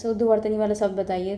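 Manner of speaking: normal talking voice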